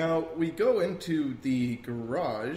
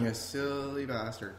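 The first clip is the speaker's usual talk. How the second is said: much softer, under the breath; muttered exasperation; 3 semitones lower, 11 dB quieter than usual. Retold or imitated. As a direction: shake your head quietly.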